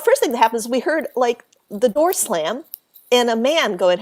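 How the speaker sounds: ordinary talk, nothing marked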